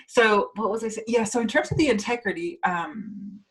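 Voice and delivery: ordinary talk, nothing marked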